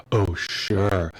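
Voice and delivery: a hint of a snide classmate; sarcastic oh sure. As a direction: scoff it back in a put-on voice. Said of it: "accuser's sarcastic reply".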